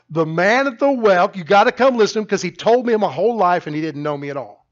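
loud, high, urgent proclamation; excited testimony. Retold or imitated. Preacher voicing woman at the well telling townsfolk, loudly excited. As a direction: call out like an amazed witness.

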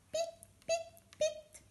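very high, tiny separate pips; imitated tiny plops. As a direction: squeak out three little dropping noises. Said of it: "io la faccio così". (suoni piccolissimi e acuti)

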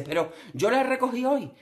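high, fussy complaining voice; whiny boyfriend. Retold or imitated. Imitating boyfriend nagging about the dirty kitchen, whiny. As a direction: nag like a fussy partner. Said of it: "Y dice".

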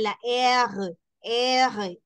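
French R made in the throat; guttural R. Shown as a demonstration. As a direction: say the R from the back of the throat. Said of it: "la pronunciación gutural". (R gutural francesa)

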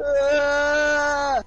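sudden loud startled yelp; startled shout. Real, not acted. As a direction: cry out in sudden fright. Startled by enemy.

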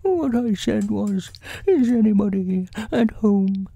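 high bear voice calling out; Pooh. A narrator's reading. Bear calling again into the silent hole, high-pitched call. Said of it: "called out Pooh".

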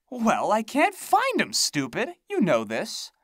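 high, loud scornful hag; scornful crone. A narrator's reading. Hag scolding the narrator, high and scornful. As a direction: sneer it like a cranky witch.

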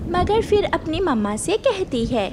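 ordinary delivery

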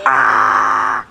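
sharp loud yell of frustration; frustrated shout. Real, not acted. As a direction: yell out at the fail. Failing the jump.

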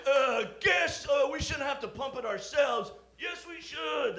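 high, loud, slow dopey debaters; shouted mock debate. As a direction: overplay the dumb debate kids. Mock debate team voices.

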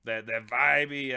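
usual speaking voice

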